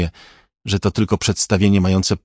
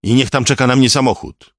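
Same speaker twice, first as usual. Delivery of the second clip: louder, higher insistent call; shouted demand; 5 semitones higher, 5 dB louder than usual. A narrator's reading. Hostage-taker demanding a getaway car, shouted demand. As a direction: demand it in a raised voice. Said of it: "krzyknął".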